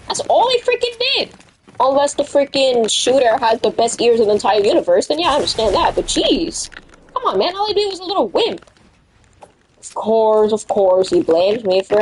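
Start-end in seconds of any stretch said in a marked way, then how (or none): 0.1-1.3 high-pitched indignant outburst; exasperated protest. Retold or imitated. Kid defending himself after being blamed, indignant. 7.1-8.6 high, quick plea; pleading protest. Retold or imitated. Kid pleading with teacher over being blamed, high protest.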